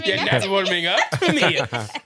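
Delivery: laughing mock wicked witch. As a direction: cackle it like a hammy witch. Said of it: "She said it like a wicked witch like that".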